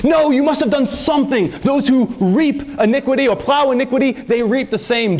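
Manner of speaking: a hint of a self-righteous accuser lecturing Job; accusing Eliphaz. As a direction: press Job as a smug friend would. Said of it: "and Eliphaz is saying".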